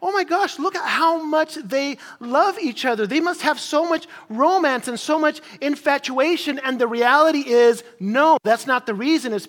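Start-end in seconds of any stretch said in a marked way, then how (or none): 0.0-6.6 high-pitched gushing onlooker; mock-starstruck church member gushing over a loving couple; gushing admirers. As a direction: gush like an impressed churchgoer. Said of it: "they'll be like".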